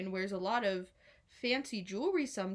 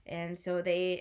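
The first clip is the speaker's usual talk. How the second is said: ordinary delivery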